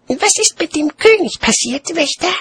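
put-on very high-pitched child voice; small child. A narrator's reading. Child questioning a watchman, high childlike voice. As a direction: ask in a high little child's voice. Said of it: "Das Kind".

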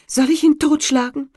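high, loud questioning wife; eager wife. A narrator's reading. Wife asking her husband whether to kill the bird, high. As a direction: ask keenly.

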